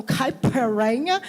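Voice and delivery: caipiranha drawn out slowly, stretched like a foreigner says it; mispronounced caipiranha. Retold or imitated. Mocking foreigners who say caipiranha, drawn out slowly. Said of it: "Os caras falam".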